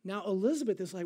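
ordinary delivery

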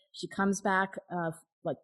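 ordinary talk, nothing marked

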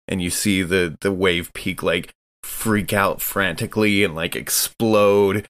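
usual speaking voice

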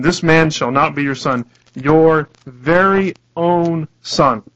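loud, slow, separate punches on "Your very own son"; very clear, emphatic. Retold or imitated. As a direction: hammer out "very own son" word by word. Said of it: "he's very clear".

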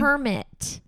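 PERMIT said slowly with a firm stressed beat; stress correction on permit. Shown as a demonstration. Daughter correcting her mom's word, deliberate stress on PERMIT.

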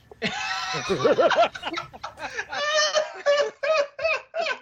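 shrieking high laugh; high cackle. Real, not acted. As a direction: cackle wildly, high and loud. Cackle after threat.

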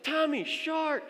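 high, slow, stretched call; drawn-out high holler at moderate volume; mock warning yell. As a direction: re-enact calling out to someone who isn't listening. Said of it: "And I'm yelling".

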